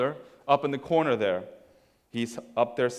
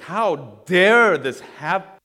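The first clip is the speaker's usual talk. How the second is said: slow, loud, indignant outburst; angry older brother; 6 semitones higher, 9 dB louder, much slower than usual. Acted. Older brother hearing about the party, angry. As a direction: draw out the outrage, raised pitch and volume. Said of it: "and he's angry".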